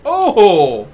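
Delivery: sudden loud startled gasp. Real, not acted. Streamer jolted by something sudden on screen, startled loud oh. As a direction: jolt out a quick loud oh at a scare.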